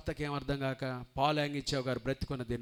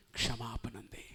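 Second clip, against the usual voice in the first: ordinary talk, nothing marked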